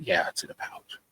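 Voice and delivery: whispering the awkward admission to the doctor; sheepish whisper. Retold or imitated. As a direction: confess it under your breath. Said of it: "And I went".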